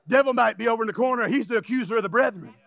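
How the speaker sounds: fast, low aside; rushed aside. Acted. Preacher hurries through a warning about the devil, fast. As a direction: rattle it off quickly.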